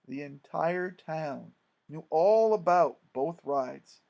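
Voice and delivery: usual speaking voice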